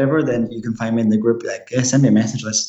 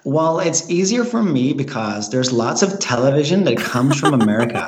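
Scottish speaker putting on an American accent. Shown as a demonstration. Guest shows the hosts their own accent, put-on American accent. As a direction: switch into a convincing American accent. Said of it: "You're really good at accents".

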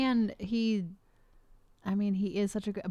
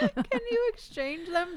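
high, slow tease; 11 semitones higher, slower than usual. Real, not acted. Joking about pretty roosters.

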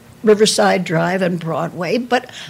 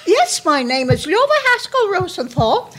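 put-on much higher, chirpy young-girl recording voice; high bird voice; 9 semitones higher than usual. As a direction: chirp your name like a bird. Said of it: "echoing their bird voices".

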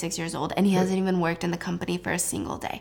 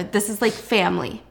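ordinary delivery

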